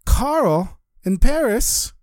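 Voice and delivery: high, slow, lit-up disbelief; the Rebbe, overjoyed at regards from Carl; delighted surprise. Acted. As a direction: savour the name with joy. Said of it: "and he was smiling".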